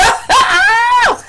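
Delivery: high-pitched, loud burst of laughter; squealing laugh. Real, not acted. Laughs at her own confession.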